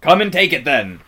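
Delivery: loud, bellowed dare; roaring challenge, high then falling; great bellow. A narrator's reading. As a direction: bellow it like a bull. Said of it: "in a great bellowing voice".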